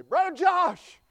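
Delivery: put-on high, loud child's voice calling out; preacher imitating a boy announcing him; loud little boy. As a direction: shout it out like an excited kid. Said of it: "he said quite loudly".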